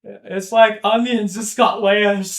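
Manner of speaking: slow, loud cartoon-ogre impression; Shrek. Friend quoting the ogre's onion speech for laughs, slow and loud. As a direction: draw it out loudly like the ogre. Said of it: "And then Shrek's just like".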